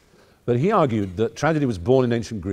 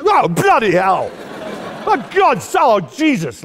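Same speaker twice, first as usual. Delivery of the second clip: much higher and louder, a hammed-up outburst; mock outraged exclaim; 13 semitones higher, 9 dB louder, slower than usual. Hammed-up outburst at a rough player, much louder. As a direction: blurt it like someone bumped mid-game. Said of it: "like that".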